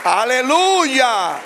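high, loud, drawn-out praise shouts; shouted amen. Acted. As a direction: call out to the church at full force. Shouts amens to congregation.

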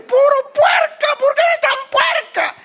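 shrieking high-pitched scold at a filthy pig; exasperated owner screaming at the pig; scolding yell. Retold or imitated. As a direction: yell it up high and loud, fed up. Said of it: "y usted va a decir".

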